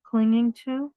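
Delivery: lively storybook reading; reading to children. A narrator's reading. Reading a picture book to children, lively storybook voice. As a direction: read it out warmly to the little ones.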